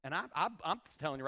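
normal talking voice

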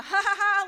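loud, high mock laugh; forced joking laugh. Preacher imitates husband dodging tears with jokes, forced laugh. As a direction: laugh over the tears, hammed-up. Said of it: "a joke started happening".

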